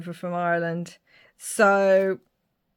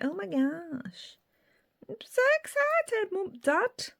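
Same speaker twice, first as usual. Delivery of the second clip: very high squeaky thrilled voice; excited daughter; 13 semitones higher than usual. Acted. Daughter thanking mum and dad for the key, high excited squeal. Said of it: "And she's like".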